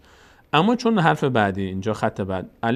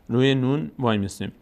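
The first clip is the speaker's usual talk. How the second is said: normal talking voice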